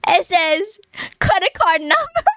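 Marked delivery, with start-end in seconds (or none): 1.2-2.2 disbelief. Real, not acted. Reading line in disbelief.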